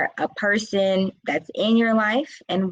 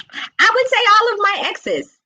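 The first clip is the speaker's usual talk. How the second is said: much higher and louder, a bold declaration; emphatic exclamation; 5 dB louder than usual. Real, not acted. Answering her own question about exes, high and emphatic. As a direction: announce it with a laughing flourish.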